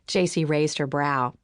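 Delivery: usual speaking voice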